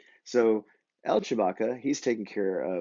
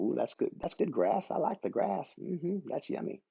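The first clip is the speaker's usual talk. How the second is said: higher, softer sheep voice, savouring; a contented little lamb enjoying his grass, voiced higher; lost sheep munching grass; 6 semitones higher, 4 dB quieter than usual. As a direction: puppet sheep nibbling, higher and soft. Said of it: "he's eating the".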